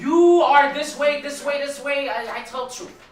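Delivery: loud, high accusation with a drawn-out opening; mock accusing. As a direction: imitate someone loudly pointing out others' faults. Mimics a harsh blunt truth-teller.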